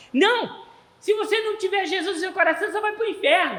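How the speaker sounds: put-on high, loud, rushed little-boy warning; Josué Mateini. Small boy warning his playmate about hell, childlike and emphatic. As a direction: imitate a child insisting forcefully. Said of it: "ele era pequenininho".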